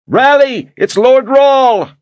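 high, loud battle cry; rallying shout. Acted. Voices pass the word through the horde, shouting. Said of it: "Voices carried the shout".

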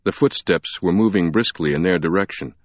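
ordinary delivery

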